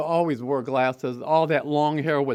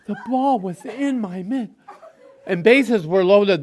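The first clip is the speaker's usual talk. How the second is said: ordinary talk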